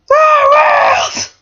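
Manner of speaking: very high, loud, stretched squeal; excited scream. Acted. Both friends watch narwhals swim by, ecstatic scream. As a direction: shriek it in sheer delight.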